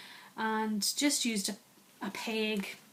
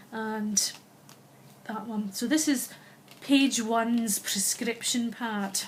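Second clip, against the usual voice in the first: ordinary delivery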